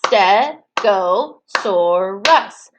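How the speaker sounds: "Stegosaurus" very slowly, one beat per syllable; teacher chanting "Stegosaurus" syllable by syllable; clapped-out syllables. Shown as a demonstration. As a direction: split "Stegosaurus" into four spaced chunks. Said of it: "Let's do it again".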